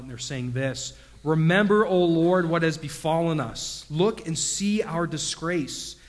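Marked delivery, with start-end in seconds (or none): 1.2-3.9 loud anguished plea, much louder; crying out to God. Acted. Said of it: "Israel's crying out". 3.9-6.0 slow, weighty plea, a bit louder; slow lament. Acted. Scripture lament read aloud.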